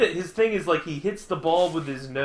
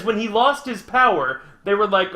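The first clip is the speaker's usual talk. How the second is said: ordinary talk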